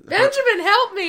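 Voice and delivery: high, loud cry for help; hammed-up scream for rescue; mock scream. Wife calling her father-in-law into the hallway, mock screaming. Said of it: "just starts screaming bloody murder".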